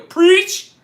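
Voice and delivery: loud, high-pitched yell; shrill drawn-out shout of approval; mock congregation cheer. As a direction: holler it like a worked-up churchgoer.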